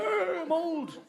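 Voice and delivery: high, put-on elderly whine; feeble old-person groan. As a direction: groan as your startled older self. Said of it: "someday I'll be old".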